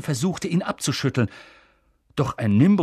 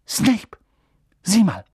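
higher and louder, urgent; urgent call; 7 semitones higher, 5 dB louder, slower than usual. Acted. Hermine urging Ron to look, urgent. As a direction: point him out fast.

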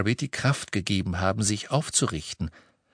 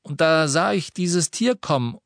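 put-on higher, louder young lad; lively teenage boy voice; Ron's voice; 7 semitones higher, 4 dB louder than usual. A narrator's reading. Ron voiced in story. (höhere Jungenstimme für Ron)

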